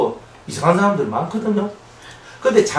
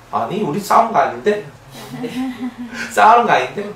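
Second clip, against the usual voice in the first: laughing denial; 5 semitones higher than usual. Retold or imitated. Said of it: "그냥 얘기하는 거야".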